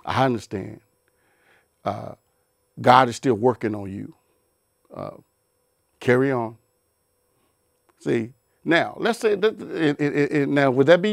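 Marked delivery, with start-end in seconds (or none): 0.0-6.6 low, gentle and forgiving. Retold or imitated. The spouse answering the partner a second time, calm and accepting. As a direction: let them off kindly, pitched low. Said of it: "would your response be".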